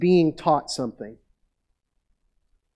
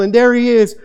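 usual speaking voice

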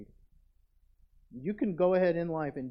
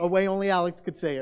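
ordinary talk

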